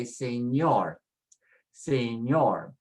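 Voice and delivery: señor drawn out slowly, weight on ÑOR; stress on last syllable. Shown as a demonstration. Teacher models the second rule, stressing señor on ÑOR. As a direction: say señor, leaning on the final syllable. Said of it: "So we put, we say señor".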